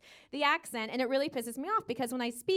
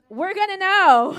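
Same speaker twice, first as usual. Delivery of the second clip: loud warning; 3 semitones higher, 10 dB louder, slower than usual. Acted. Warning French liars.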